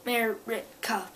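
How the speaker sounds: "America" slowly broken into separate syllables. Shown as a demonstration. Bully making sure girl can understand, slow "America". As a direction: draw out each syllable of "America". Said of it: "in, like, a way that, so she can kinda understand it".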